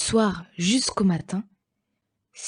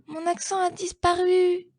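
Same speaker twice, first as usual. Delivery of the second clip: much higher, whining voice; high, plaintive moan; moaning scatterbrain; 11 semitones higher than usual. Acted. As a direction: wail it like a careless little word. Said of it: "gémissait Étourdi".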